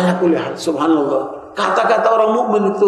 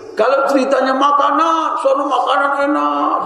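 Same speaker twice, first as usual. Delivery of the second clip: mock food enthusiasm; 8 semitones higher than usual. Preacher teasing people who only talk food, mock gushing. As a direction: send up a food lover in a raised singsong.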